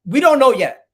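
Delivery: sudden loud frustrated blurt; frustrated outburst. Real, not acted. Fan on stream blurting out frustration loudly. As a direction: burst out at the bad news.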